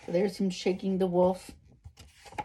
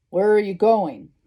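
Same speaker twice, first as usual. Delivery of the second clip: lightly growled question; a hint of a growly wolf; growling wolf; 2 semitones higher, 7 dB louder than usual. A narrator's reading. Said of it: "growled the wolf".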